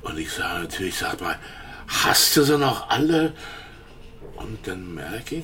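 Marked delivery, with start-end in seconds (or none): 1.9-3.3 higher, louder indignant outburst; indignant retort. Retold or imitated. Witness retelling his retort to Starke, indignant. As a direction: snap back in disbelief.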